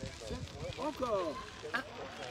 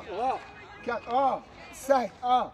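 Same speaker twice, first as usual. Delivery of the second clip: loud; 3 semitones higher, 9 dB louder, much slower than usual. Real, not acted. Coach pushing through last reps.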